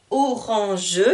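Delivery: "orange" in three even syllables, final e sounded; découpé en syllabes. Shown as a demonstration. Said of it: "en syllabes". (« orange » prononcé syllabe par syllabe)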